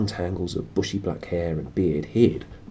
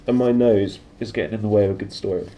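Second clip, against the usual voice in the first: throat still catching; after a coughing fit; 3 semitones higher, 5 dB louder than usual. Real, not acted. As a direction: talk through a tickly throat.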